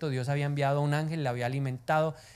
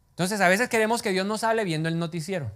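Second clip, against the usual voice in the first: ordinary delivery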